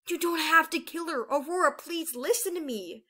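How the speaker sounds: high, loud plea, slowing at the end; urgent pleading. A narrator's reading. Peril begging Aurora to spare Bermuda, urgent. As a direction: beg to be heard. Peril begs her sister.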